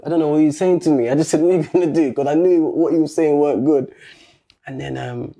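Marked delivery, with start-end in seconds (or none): 1.4-2.1 loud, defiant shot back at him; loud challenge. Retold or imitated. Squaring up to a taller man, loud defiant challenge. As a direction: throw the question in his face.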